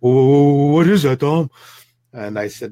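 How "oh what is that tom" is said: goofy Trevor. Retold or imitated. Buck-toothed Trevor asks Tom about the smell, loud goofy voice. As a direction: ham up a startled buck-toothed guy. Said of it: "he's got this big nose and big buck teeth".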